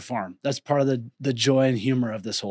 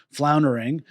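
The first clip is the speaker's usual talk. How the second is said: ordinary talk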